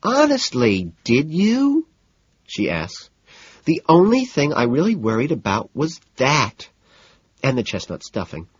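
high, fussy woman's voice. A narrator's reading. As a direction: voice a fretting socialite. Evelyn's voice.

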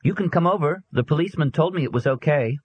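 put-on high, eager boy; young boy voice. A narrator's reading. Boy calling to girls.

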